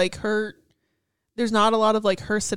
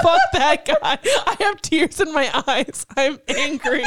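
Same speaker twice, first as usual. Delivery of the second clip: laughing rage; 2 semitones higher than usual. Real, not acted. Cohost losing it over the alien, laughing rage. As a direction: curse out the alien while cracking up. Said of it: "You have never lost your shit that much".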